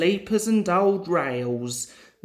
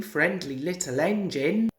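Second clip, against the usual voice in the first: usual speaking voice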